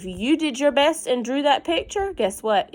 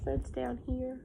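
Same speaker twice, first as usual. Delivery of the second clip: soft whispered aside; 4 semitones higher, 10 dB quieter than usual. Real, not acted. Teacher whispering to pupils about the picture, hushed. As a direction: whisper it to the class like a secret.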